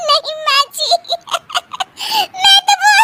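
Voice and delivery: high, broken-off protest swallowed by laughter; squeaky laughing fit; helpless laughter. Acted. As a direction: try to explain and collapse into giggles. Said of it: "बोल रही हो कि हंस नहीं रही".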